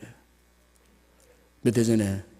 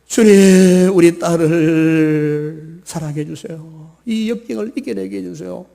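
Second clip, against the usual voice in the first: slow, louder half-sung prayer; mother praying for her daughter over the phone; 5 semitones higher, 9 dB louder, slower than usual. Acted. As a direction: plead to the Lord in a chanting voice. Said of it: "엄마가요, 이 전화통 붙잡고".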